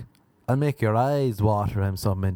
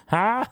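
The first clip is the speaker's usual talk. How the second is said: high, short "huh"; mock orgasm cry; 8 semitones higher than usual. Woman in bed having an orgasm, high mock huh. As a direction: imitate an odd high climax yelp. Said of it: "she'd be like".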